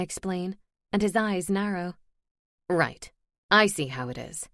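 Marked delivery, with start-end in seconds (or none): 2.7-4.5 low, cold accusing male voice. Acted. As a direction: say it like an accusation, lower. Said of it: "accusing me".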